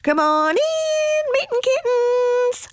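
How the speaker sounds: high, drawn-out singsong call. A narrator's reading. Mama cat calling her kittens indoors, sung-out high call. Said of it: "called out Mama Junebug Jones".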